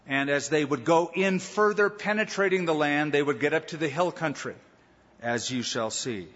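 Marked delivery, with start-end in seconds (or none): none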